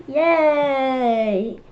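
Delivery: loud high cheer; cheering yay. Acted. Kid voicing David cheering as he goes home. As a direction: shout it with joy.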